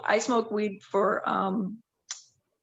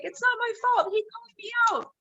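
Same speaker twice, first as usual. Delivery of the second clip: pitched higher, protesting innocence; mock defensive protest; 11 semitones higher than usual. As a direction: play it innocent, it's not on me. Retold defence to security guard.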